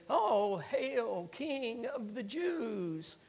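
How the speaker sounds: slow, drawn-out sarcastic hail; mocking. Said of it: "began to make fun of him".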